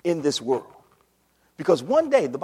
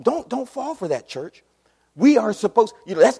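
usual speaking voice

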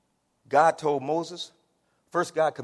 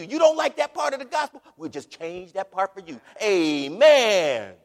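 mocking pulpit salesman, big and high-pitched; mock permissive preacher; 10 semitones higher, 13 dB louder than usual. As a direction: play a crowd-pleasing preacher talking folks round. Mocks a permissive, crowd-pleasing preacher.

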